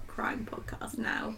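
slow hushed whisper. Pretend. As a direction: breathe it like a secret. Whispered true crime aside.

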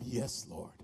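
low, hushed answer; humble surrender. Retold or imitated. Teenager answers God's call, low and hushed. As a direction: drop right down into a quiet yes.

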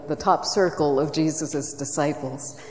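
ordinary talk

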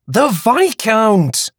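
high, very slow swooning exclamation; breathless awe. A narrator's reading. Woman swooning over the Viscount to Hattie. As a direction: savour the title.

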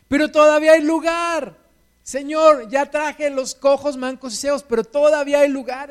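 más agudo y fuerte, criado que anuncia con ganas; el criado grita que aún hay lugar; criado entusiasmado. Acted. El criado informa al señor. (criado anunciando a su señor, agudo y fuerte)

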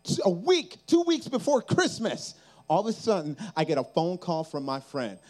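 ordinary delivery

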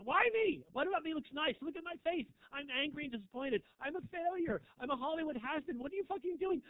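high, exasperated self-pitying rant; ranting has-been. Retold or imitated. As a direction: whine it out like a washed-up star on a bus.

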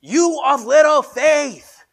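high, loud, slow shout; Jesus shouting to disciples over the storm; yelling over storm. Acted. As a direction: yell it over the wind. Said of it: "replies and yells".

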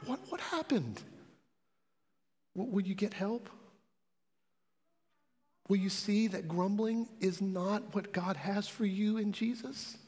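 gentle. Real, not acted. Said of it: "I'm trying to be gentle".

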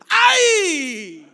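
sharp loud cry of mock pain; loud preacher's ouch. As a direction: yelp as if something stung. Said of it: "Dolió".

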